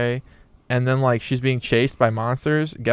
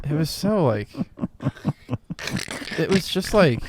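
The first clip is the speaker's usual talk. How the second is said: zombie impression; 5 semitones higher than usual. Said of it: "[name]'s doing a Doctor Strange zombie impression".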